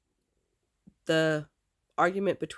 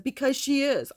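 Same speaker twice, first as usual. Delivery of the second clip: higher, heated retold comeback; re-enacted angry retort; pissed-off retort; 7 semitones higher, 4 dB louder than usual. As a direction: fire back indignantly, pitch jumping up. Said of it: "I, I was pissed".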